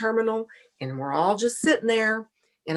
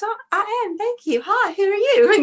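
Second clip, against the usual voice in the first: high, bright Australian lilt, raised and louder; an excited Australian fan greets a stranger, high and bubbly; Megan's greeting; 13 semitones higher, 5 dB louder than usual. Retold or imitated. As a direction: mimic the cheerful fan greeting her. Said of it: "And she goes".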